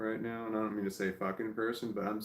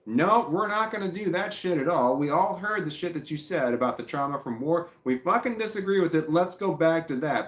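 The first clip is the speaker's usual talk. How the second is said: higher, louder angry yelling at the chatter; furious shout; 7 semitones higher, 5 dB louder than usual. Real, not acted. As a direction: really lose it and shout him down. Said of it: "Now I'm getting pissed off".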